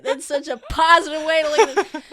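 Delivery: high, loud words through laughter; laughing. Real, not acted. Game master teasing player's optimism, laughing loudly. Joking about poor rolls.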